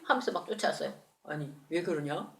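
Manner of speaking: usual speaking voice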